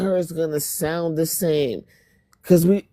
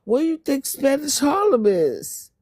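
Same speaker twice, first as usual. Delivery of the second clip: sharp disbelieving question, pitched much higher; incredulous retort; 9 semitones higher than usual. Real, not acted. Answering a commenter who doubts her, incredulous. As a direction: scoff at how obvious it is.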